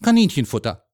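put-on loud, dismissive boy; disdainful. A narrator's reading. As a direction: toss out the guess with scorn. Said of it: "meinte Jürgen geringschätzig".

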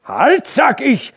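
loud, high, slow commanding yell; furious shout. A narrator's reading. Hauke furiously shouting at the workers again. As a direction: slam the order down hard. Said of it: "schrie Hauke wieder".

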